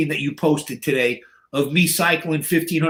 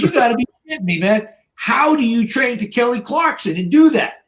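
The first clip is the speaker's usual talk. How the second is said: high-pitched, baffled protest; imitating a flabbergasted gym bro; retold incredulity; 8 semitones higher than usual. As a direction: demand an explanation in high disbelief. Retold guy astonished by the music.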